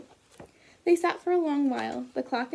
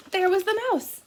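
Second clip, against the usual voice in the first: surprised; 8 semitones higher, 6 dB louder than usual. A narrator's reading. Surprise reveal in read-aloud.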